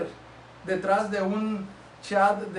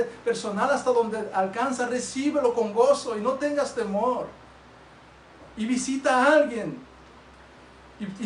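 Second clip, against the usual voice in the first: fervent preaching; 5 semitones higher, 4 dB louder than usual. Acted. Preaching at full force.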